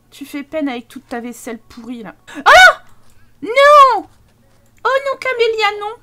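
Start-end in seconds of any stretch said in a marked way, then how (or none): 2.4-5.9 high, loud dismayed cry; real alarmed outburst at the game; shocked cry. Real, not acted. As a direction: cry out at the disaster. Reacting to Sim's mishap.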